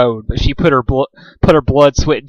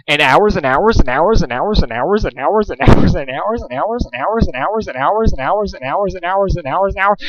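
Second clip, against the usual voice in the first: singsong endless repeating of hours; droning loop; 4 semitones higher than usual. Acted. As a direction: chant it on and on like a broken record.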